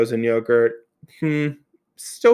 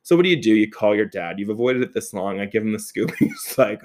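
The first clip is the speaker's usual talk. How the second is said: ordinary delivery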